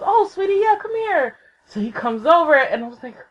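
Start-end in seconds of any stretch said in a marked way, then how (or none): 0.0-1.4 very high, cooing welcome; imitated kindly celebrity; doting sweetness. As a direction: coo it high, like greeting a darling. Voices idol greeting a fan.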